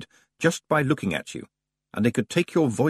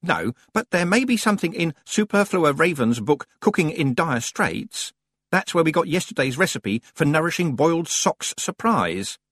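lightly voiced, higher-pitched librarian; deputy librarian; 8 semitones higher than usual. Deputy librarian suggesting a cookbook, lightly voiced higher. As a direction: answer a colleague in a raised librarian voice.

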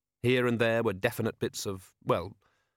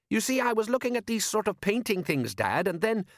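put-on much higher eager boy's voice; young boy voice; 11 semitones higher than usual. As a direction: pitch up like an eager lad. Son character speaking.

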